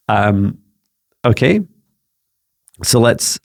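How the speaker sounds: ordinary talk, nothing marked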